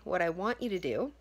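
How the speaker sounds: ordinary talk, nothing marked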